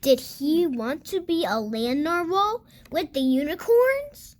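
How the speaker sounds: usual speaking voice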